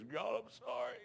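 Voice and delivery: high, breaking plea to God, imitating the friend; a grown man begging forgiveness through tears, pitched high; crying prayer. Preacher voicing crying Eddie asking God's forgiveness, high and pleading. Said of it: "old Eddie was crying".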